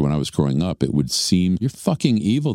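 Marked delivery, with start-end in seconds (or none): 1.6-2.5 higher-pitched mock accusation; imitated moral outrage; mock condemnation. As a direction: act out a scandalised accuser. Voicing culture's condemnation of loving two.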